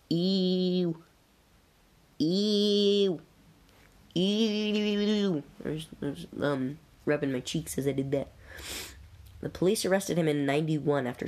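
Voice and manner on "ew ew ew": drawn-out grossed-out "ew", pitched up; disgusted ew. Real, not acted. Reacting to gross crime details.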